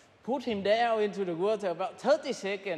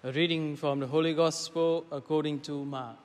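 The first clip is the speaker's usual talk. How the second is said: solemn liturgical reading voice; Gospel reading; 3 semitones lower than usual. Acted. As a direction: read scripture aloud in church.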